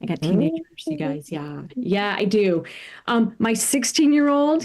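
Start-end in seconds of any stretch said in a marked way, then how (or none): none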